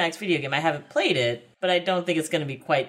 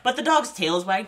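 normal talking voice

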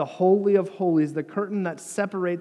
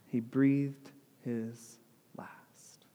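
very slow, fading to a whisper; hushed death narration; 3 semitones lower, 6 dB quieter, much slower than usual. A narrator's reading. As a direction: let the final word die away almost without voice. Solemn telling of Jesus' death.

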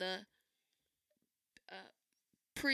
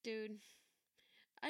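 ordinary talk

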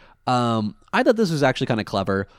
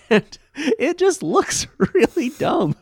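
laughing; 5 dB louder, slower than usual. Real, not acted. Laughing at the dumb design.

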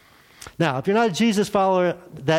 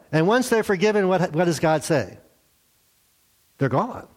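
usual speaking voice

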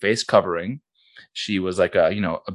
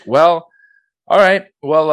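higher, louder hesitant goodbye; awkward; 8 semitones higher, 7 dB louder, slower than usual. Retold or imitated.

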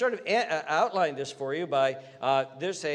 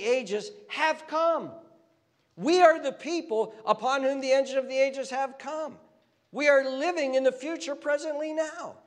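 higher, louder rising sermon voice; fervent preaching; 6 semitones higher, 4 dB louder than usual. Acted. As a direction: preach it, building with passion toward the climax. Preaching builds to fervent peak.

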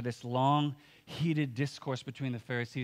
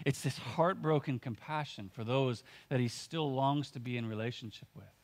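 usual speaking voice

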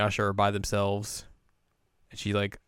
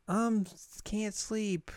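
put-on small kid voice; 10 semitones higher, slower than usual. Kid can't sleep and worries about Kikyo, high small voice. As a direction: talk like a sleepy little kid. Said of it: "and this kid's like".

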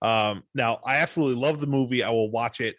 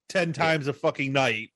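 normal talking voice

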